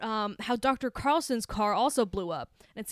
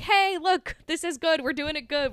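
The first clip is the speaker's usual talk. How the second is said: high, louder pep talk; cheering Jacob on as if you were his girlfriend; eager encouragement; 7 semitones higher, 6 dB louder than usual. Retold or imitated. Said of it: "And I'm like".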